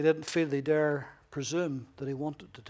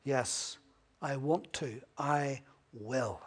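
low, soft assurance; reassuring; 5 semitones lower, 8 dB quieter, slower than usual. Retold or imitated. Jesus assuring the leper, low and soft. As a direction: gently promise it. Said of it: "no doubt about it".

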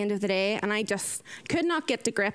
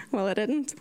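normal talking voice

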